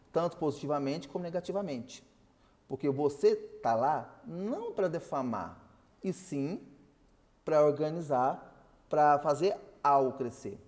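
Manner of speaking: ordinary talk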